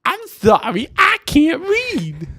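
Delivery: loud, high, drawn-out squeaky voice; exaggerated falsetto movie impression; Whitey. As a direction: squeal it slowly like a cartoon character. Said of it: "I can't do it well on mic".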